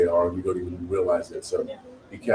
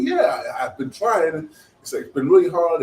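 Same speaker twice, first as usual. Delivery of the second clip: high-pitched imitation of an overwhelmed woman; mock tearful reply, voice pitched high; emotional woman's reply; 8 semitones higher, 3 dB louder than usual. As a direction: plead it in a raised, put-on voice. Retold gym woman about to cry.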